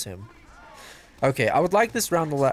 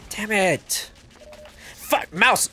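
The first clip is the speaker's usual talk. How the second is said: yell at mouse; 7 semitones higher, much slower than usual. Real, not acted. Gamer yells at his stuck mouse, exasperated. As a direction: snap at the mouse like it's a naughty pet.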